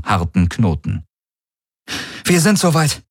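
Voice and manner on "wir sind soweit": high, alert crew report; ready report. A narrator's reading. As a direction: report readiness in a raised voice. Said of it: "meldete Kirai dem Feldherren". (hohe, angespannte Bereitmeldung)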